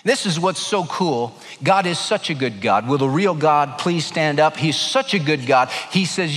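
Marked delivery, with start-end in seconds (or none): none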